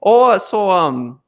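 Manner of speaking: high, chatty Midwestern grandma lilt; sing-song North Dakota granny offering casserole. Acted. North Dakota grandma inviting friend over for casserole, chatty lilt. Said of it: "North Dakota grandma".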